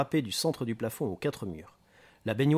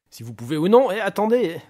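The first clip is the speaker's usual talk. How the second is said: loud, high-pitched interruption; agitated outburst of disbelief; outraged; 8 semitones higher, 10 dB louder than usual. Acted. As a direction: cut in, appalled and angry. Booker outraged by uncertain expert.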